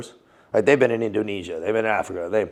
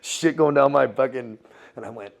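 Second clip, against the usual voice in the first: ordinary talk, nothing marked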